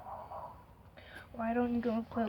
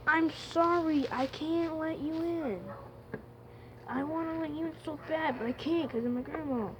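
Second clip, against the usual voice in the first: pleading apology; 5 semitones higher than usual. Real, not acted. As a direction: whine it regretfully, pitched up. Apologising to cat left outside.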